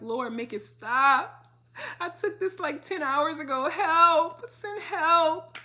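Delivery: high, loud hammed-up cry for help; desperate plea. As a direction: beg the Lord dramatically, voice climbing. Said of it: "I'm just crying 'cause I'm like".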